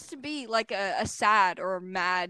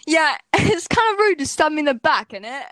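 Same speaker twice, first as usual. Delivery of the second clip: loud, high, hammed-up British accent; exaggerated British accent, breaking into a laugh; mock British accent; 5 semitones higher, 7 dB louder than usual. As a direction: put on a cheeky British meme accent. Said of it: "British people be like".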